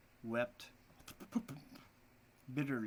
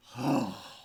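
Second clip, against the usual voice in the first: a long heavy sigh out loud; heavy acted sigh; 6 dB louder than usual. A heavy sigh for the poor father. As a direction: let out a big weary sigh. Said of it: "He sighed heavily".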